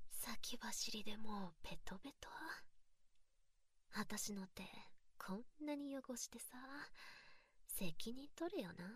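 breathy teasing whisper close to the ear. Acted. Mocking her partner in a close, teasing whisper. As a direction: whisper it like a secret taunt.

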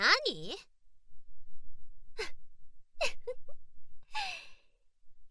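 loud, high burst of mocking laughter; loud mocking cackle. Laughing at her humiliated partner, loud mocking cackle. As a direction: cackle at him, full of scorn.

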